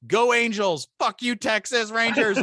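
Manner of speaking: loud, slow, high parting jab; a rival fan's brash, drawn-out taunt; defiant shout. Retold or imitated. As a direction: shout it at him as you walk away. Defiant parting shot at client.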